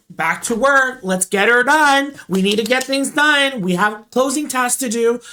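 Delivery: high, loud mock pep talk; rally the crew on pure adrenaline; hammed-up go-getter enthusiasm; gung-ho rallying. Retold adrenaline-fuelled rallying to work.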